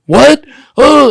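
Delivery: outraged shock. Retold or imitated. Man insulted by a stranger, loud shocked outrage. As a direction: jolt up in offended disbelief.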